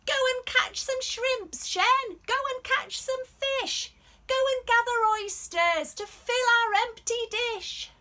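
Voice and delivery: high, loud calling voice; a bossy family member shouting orders. Acted. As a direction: yell it out to Shen from afar. Shen's family calling her.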